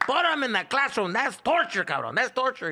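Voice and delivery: high, loud mock dad; hammed-up father declaring torture; voicing dad. Son voicing dad demanding classroom, loud mock indignation. Dad's rant retold.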